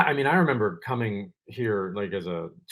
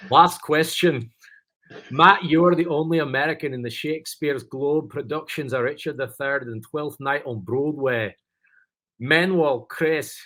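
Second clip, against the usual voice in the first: higher-pitched, put-on Scottish reading; Scottish accent; 5 semitones higher than usual. Shown as a demonstration. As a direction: read the question in a hammed-up Scottish accent. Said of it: "I'll follow it up with my Scottish".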